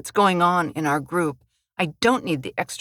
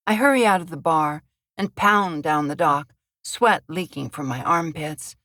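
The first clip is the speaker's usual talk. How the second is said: plain reading voice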